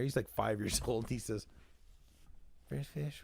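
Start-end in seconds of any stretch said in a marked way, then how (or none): 2.7-3.2 very soft little utterance; Curtis. Retold or imitated. Five-year-old on a fishing trip speaking up, very quiet. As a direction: imitate a quiet child, barely audible. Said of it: "Curtis is all quiet and he's sitting there".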